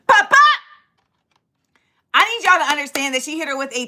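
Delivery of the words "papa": higher, loud smack cry; mock smack sound. Sister hitting the woman, loud mock slap sound. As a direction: pop it like a slap. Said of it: "Sis said".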